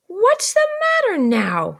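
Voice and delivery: high, loud, slow sharp demand; much sharper. Acted. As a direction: bark the question even sharper. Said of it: "more sharply than before".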